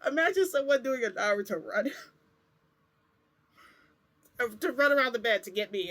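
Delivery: real laughter breaking in, high and loud; amused laughing. Real, not acted. Storyteller picturing a Naruto run round the bed, laughing. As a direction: tell it while cracking up. Cracks up at her own image.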